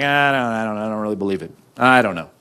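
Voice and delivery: low, reluctant doubter's voice; doubtful mumbler. Acted. Forgiven child to dad, doubtful and low.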